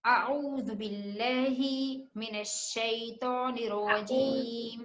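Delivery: very slow, drawn-out recitation for students to repeat; slow Quranic recitation. Shown as a demonstration. Teacher leads students in a very slow recitation to repeat. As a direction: recite it slowly, word by word, for the class. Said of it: "आप लोग भी मेरे साथ-साथ धीरे-धीरे repeat करते जाइएगा".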